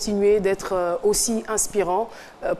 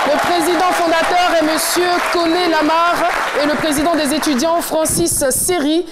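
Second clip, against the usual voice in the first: show host hype; 6 semitones higher than usual. Acted. TV host welcoming guests to the studio, hyped announcer voice. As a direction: greet the studio crowd like a game-show host.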